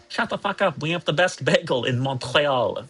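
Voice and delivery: indignant put-on Montreal accent, starting high; mock Montreal Canadian. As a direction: brag in a hammed-up Montreal accent. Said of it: "Canadian, by the way".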